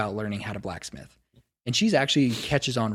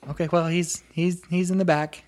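a little higher, a hint of the gnome; light, slightly raised gnome voice; lightly voiced gnome; 6 semitones higher, 4 dB louder than usual. Gnome apprentice pointing the visitor to the back, lightly higher. Gnome Nadella answers again.

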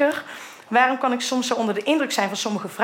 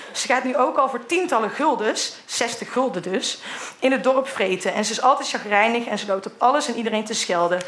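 ordinary talk

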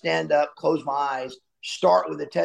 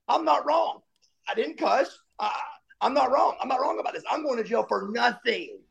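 higher, insistent denial; indignant protest; 8 semitones higher, 3 dB quieter than usual. Retold or imitated. Recalling his first reaction to the charge, higher indignant denial. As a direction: protest your innocence, pitched up.

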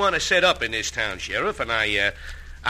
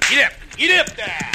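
calling to horses; 6 semitones higher, 3 dB louder than usual. Acted.

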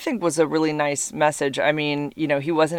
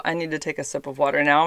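normal talking voice

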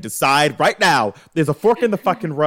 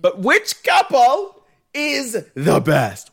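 slow, high announcer boom; 9 semitones higher, 5 dB louder, much slower than usual. Acted. Host teasing the episode question, slow and high announcer style. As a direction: ask it like a game show host.

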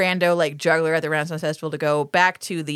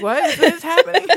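higher, giggling through the line; laughing dizzy woman; 9 semitones higher, 4 dB louder than usual. Retold or imitated. Lillian dizzy from the nosebleed, laughing. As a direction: laugh while asking, dazed. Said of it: "she's like".

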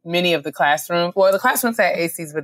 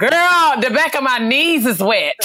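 loud hallway talk; 8 semitones higher, 4 dB louder, slower than usual. Retold or imitated. Loud hallway gossip retold.

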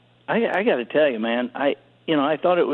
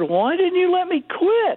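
much higher, slow plea; pleading question. Retold or imitated. Son asking his dad why he wasn't let quit, high pleading. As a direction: ask it pitched way up, like the kid still hurt. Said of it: "And I said".

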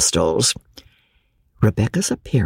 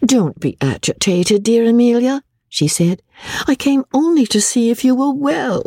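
false soothing; 9 semitones higher than usual. Acted. Rebecca soothing Amelia.

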